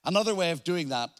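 ordinary delivery

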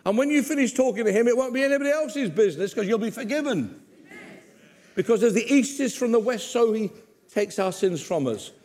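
usual speaking voice

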